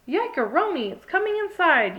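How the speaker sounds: high, loud alarmed yelp; frightened high yell. A narrator's reading. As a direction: cry out as it comes in. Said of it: "yelled Zoe".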